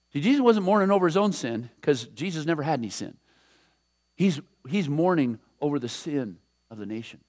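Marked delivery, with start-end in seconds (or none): none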